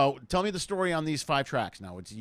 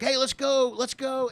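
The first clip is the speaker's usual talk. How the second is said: high, mildly raised mock cheer; imitated party screaming; 9 semitones higher, slower than usual. Imitating vacationers urging the entertainer to party, high mock yell. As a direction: urge them on like a partying crowd. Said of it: "everyone is just screaming".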